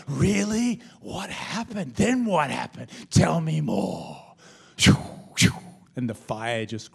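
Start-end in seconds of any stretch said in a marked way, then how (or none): 0.1-4.4 loud, hungry-for-scandal questioning; eager gossip. Acted. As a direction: egg the rumour on, drawing out the last words. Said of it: "put the kerosene on it and go".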